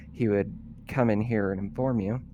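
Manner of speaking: ordinary delivery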